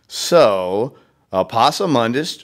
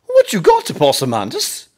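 giggly Raccoon; 6 semitones higher than usual. Acted. Raccoon voiced with laughs.

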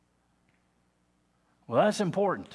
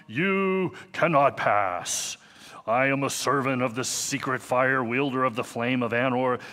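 bad Gandalf impression; 6 dB louder than usual. Preacher imitating Gandalf facing the Balrog, loud booming command. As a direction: thunder it at the Balrog like a wizard. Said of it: "I was really a bad imitation".

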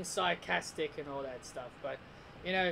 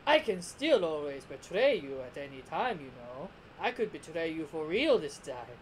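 sly villain with swooping pitch; sarcastic, singsong menace; Frieza; 3 semitones lower than usual. Acted. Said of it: "I'm doing that voice".

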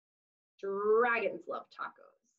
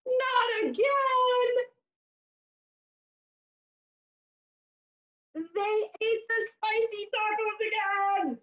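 dismayed exclaim; 9 semitones higher than usual. Acted. Dragons ate tacos again.

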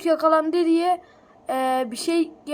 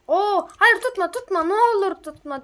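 mock pleading; 5 semitones higher, 4 dB louder than usual. Pleading with game fish.

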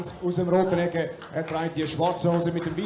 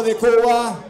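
louder, falling Cuba called out; announcer hype; 3 semitones higher, 6 dB louder than usual. Acted. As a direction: punch out "Cuba" with a falling call. Spanish fighter introduction.

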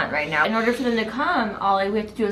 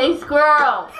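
louder squirrel call; 3 semitones higher, 4 dB louder, slower than usual. Shown as a demonstration. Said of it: "Okay, ready?".

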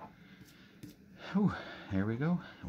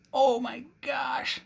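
much higher, slow drawn-out cry; real excited squeal; 14 semitones higher, slower than usual. Real, not acted. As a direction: let the joy squeeze it out. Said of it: "You know I get excited".

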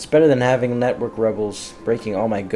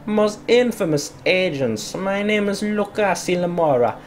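put-on Italian crime-boss accent drifting toward Russian; higher, hammed-up Italian gangster voice; bad Italian accent; 6 semitones higher than usual. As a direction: play a smooth mob boss in a half-nailed Italian accent. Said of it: "I did not nail the Italian accent".

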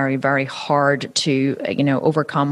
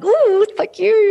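very high, slow, drawn-out flattered coo; milk the compliment; mother gushing thanks to her daughter; hammed-up delight; 4 dB louder, much slower than usual. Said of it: "I'm like".

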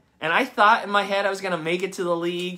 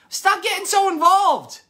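high, loud mock complaint; hammed-up brush-off of someone too involved; annoyed churchgoer; 13 semitones higher, 8 dB louder than usual. Pastor mimics a congregant pushing him away, high mock complaint. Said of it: "It's like".

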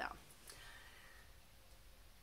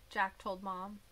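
usual speaking voice

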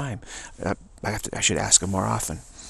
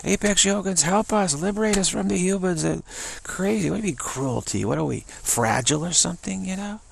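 high pleading dog voice, much higher; 9 semitones higher than usual. Retold or imitated. Dog begging the Apex Yogins for rescue, high pleading voice. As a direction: squeak it up like a desperate little dog begging. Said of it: "He says".

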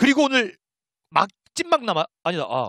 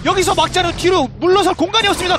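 loud, high-energy esports call; live play-by-play; 7 semitones higher, 7 dB louder than usual. Real, not acted. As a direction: shout the fight as it happens.

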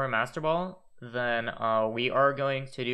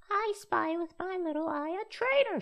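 playful voice. Pretend. Playful put-on voice.

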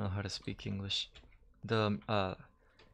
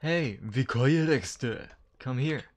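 slow, loud mock Japanese accent; 4 semitones higher, 9 dB louder than usual. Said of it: "Why you have to put that in a Japanese accent".

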